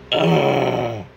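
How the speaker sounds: loud sulky groan; emo moan.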